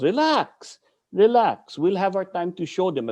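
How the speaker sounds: high, easy "relax" to settle a hothead; calming teacher. Retold or imitated. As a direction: talk him down lightly. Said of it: "He goes".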